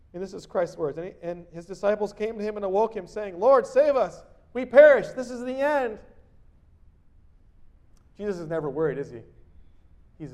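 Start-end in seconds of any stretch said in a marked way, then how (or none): 3.4-6.0 loud, high, panicked plea; desperate cry. Acted. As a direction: cry out for rescue in the storm. Disciples crying out to Christ.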